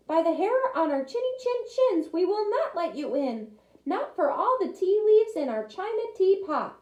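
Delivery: high little voices, firm; little wolves defiant. Acted. Little wolves defying the pig, high and firm. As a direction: stand firm in tiny voices.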